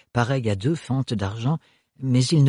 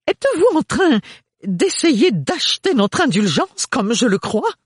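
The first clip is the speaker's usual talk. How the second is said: much higher, louder suspicious lady; a shrill-pitched accusing mother; indignant mother; 12 semitones higher, 3 dB louder than usual. A narrator's reading. The mother accusing Thorne of buying them, much higher.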